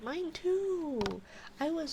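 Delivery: loud, high outburst; exclaimed commiseration. Real, not acted. Lamenting her ruined setup with chat.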